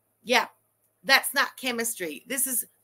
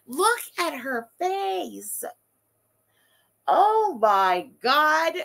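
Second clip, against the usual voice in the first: very slow, drawn-out shock; appalled disbelief; 3 semitones higher, 4 dB louder, much slower than usual. Real, not acted. Streamer reacting to a creepy photo, drawn-out disbelief. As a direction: stretch every word in appalled amazement.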